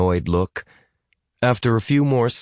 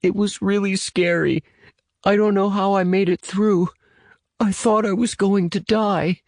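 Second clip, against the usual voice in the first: put-on much higher, frightened child's voice; tearful little boy; 13 semitones higher than usual. A narrator's reading. Boy recounts nearly dying, high and tearful. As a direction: pitch it high, tears behind the words. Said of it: "could hear tears behind the words".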